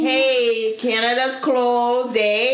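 bad Canadian accent, hammed-up; badly faked Canadian accent. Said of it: "This is the worst Canadian accent ever".

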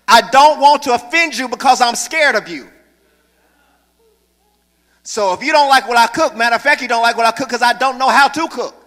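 high, loud, hammering preacher; preaching at full force. Real, not acted. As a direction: drive it home to the congregation.

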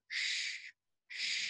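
two long, separate shushes; mimicked "shh" of annoyed French tourists; imitated shushing. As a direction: shush a stranger, hushed and drawn out. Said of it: "these two French guys behind us tap me on the shoulder".

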